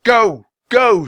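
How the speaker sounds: high, loud repeated shouts; shouting. A narrator's reading. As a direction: bark each word to drive it back. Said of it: "shouted Jack".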